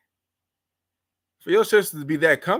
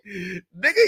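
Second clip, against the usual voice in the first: normal talking voice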